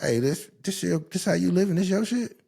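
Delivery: high, disbelieving mock challenge; mock confrontation. Imitating himself confronting a woman about her messy place, disbelieving and high.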